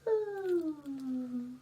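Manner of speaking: soft, hushed yawn; tiny quiet pretend yawn. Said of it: "yawning very quietly".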